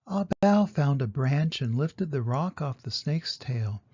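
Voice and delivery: ordinary delivery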